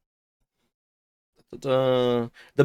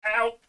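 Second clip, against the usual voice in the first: pained yelp; 10 semitones higher, 3 dB quieter than usual. Real, not acted. Cat grabbing arm.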